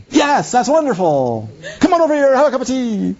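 high, grand stagey invitation; thespian host. Retold or imitated. Actor inviting a friend over for tea, theatrically. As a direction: swoop up like a ham actor. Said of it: "thespian actors trained in Shakespearean".

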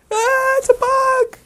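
very high, slow loud scream; mock bug panic. Someone spotting a bug, mock terrified shriek. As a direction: shriek it in hammed-up terror.

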